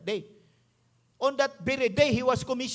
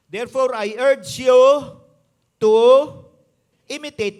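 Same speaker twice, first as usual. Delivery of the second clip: forceful scripture reading; 14 dB louder than usual. Acted. Preacher reads verse with force.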